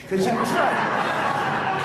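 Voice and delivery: slow, laughing, high and loud. Real, not acted. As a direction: laugh at your own foolishness. Laughing at himself over caddy story.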